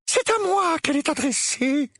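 high quavering aunt; trembling voice. A narrator's reading. Aunt Petunia claiming the letter, trembling voice. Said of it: "d'une voix tremblante".

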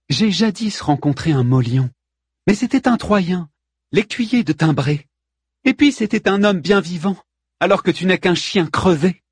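high-pitched, controlled contempt; composed retort. A narrator's reading. Queen tells looter he is dead, composed scorn. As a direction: deliver the death threat with poise. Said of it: "répondit posément Pentésilée".

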